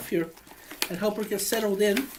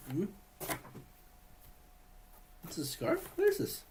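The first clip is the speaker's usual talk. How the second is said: ordinary delivery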